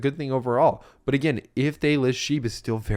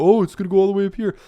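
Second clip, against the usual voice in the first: high, loud, rushed mock hype; 11 semitones higher, 8 dB louder, faster than usual. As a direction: imitate an overexcited price predictor, fast and high. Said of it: "I'm not going".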